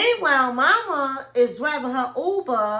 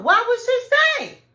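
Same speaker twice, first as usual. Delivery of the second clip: indignant; 12 semitones higher, 6 dB louder than usual. Real, not acted. Indignant rhetorical question.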